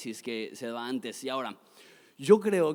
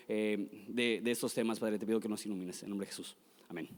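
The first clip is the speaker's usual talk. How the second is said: low, quick, subdued prayer; 3 semitones lower than usual. Real, not acted. Pastor thanking God and asking him to illuminate us, prayerful.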